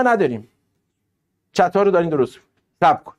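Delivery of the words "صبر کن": ordinary talk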